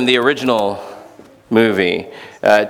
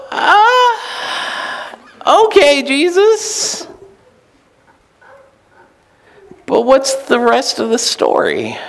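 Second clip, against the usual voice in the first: doubtful, wanting more; skeptical okay; 13 semitones higher than usual. Retold or imitated. Listeners answering Jesus, doubtful and unsure. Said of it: "we go".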